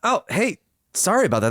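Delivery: normal talking voice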